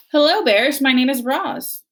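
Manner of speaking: high, bright polite robot; cheerful robot greeting; friendly robot voice. A narrator's reading.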